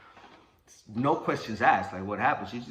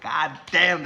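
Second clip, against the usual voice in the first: loud, higher burst of amazement; impressed exclamation; 6 semitones higher, 9 dB louder than usual. Retold or imitated. Mom impressed her son can fight, loud amazement. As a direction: let it out big, truly impressed. Mom's imagined awe at his fight.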